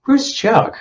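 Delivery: high, puzzled dad voice; Mr Cunningham voice. Retold or imitated. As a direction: ask like a confused sitcom father. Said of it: "he goes".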